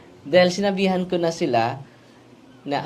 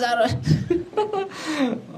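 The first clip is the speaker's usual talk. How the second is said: mock eerie laugh; 12 semitones higher than usual. Possessed laughter retold.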